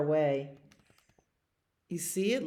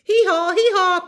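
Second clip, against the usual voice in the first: high, very loud hee-haws; blaring, high donkey call; donkey bray; 10 dB louder than usual. Acted. Donkey brays and kicks.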